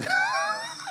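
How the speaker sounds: a sudden high mocking cackle; shrill derisive burst; high scoffing laugh. Real, not acted. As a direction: laugh it off in disbelief. Scoffing at the art buyer.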